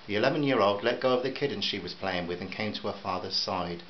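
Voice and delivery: ordinary delivery, nothing marked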